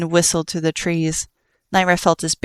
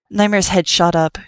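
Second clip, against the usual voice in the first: ordinary delivery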